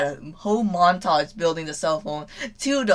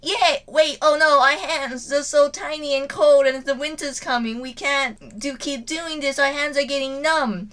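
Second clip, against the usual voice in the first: put-on much higher child voice, whiny and fretful; whining kids; 8 semitones higher than usual. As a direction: complain like cold little children. Kids whining about cold hands.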